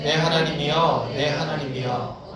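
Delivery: measured, restrained scripture recitation; reading in unison. Shown as a demonstration. Congregation reading the psalm together, restrained recitation. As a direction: read it evenly and slowly, without crying out. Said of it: "이렇게 읽으면 안 돼".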